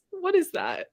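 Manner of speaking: ordinary delivery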